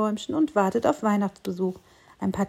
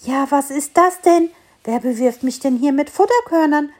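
a touch of scolding, higher and louder; 5 semitones higher, 5 dB louder than usual. A narrator's reading. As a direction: tell off the unseen thrower. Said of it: "schimpfte der kleine Tannenbaum".

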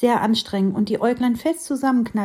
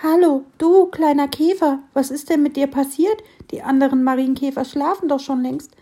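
higher, louder concerned fir tree; worried; 5 semitones higher, 4 dB louder than usual. Acted. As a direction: ask with worry. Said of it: "fragte besorgt".

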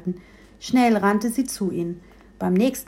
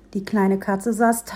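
plain reading voice

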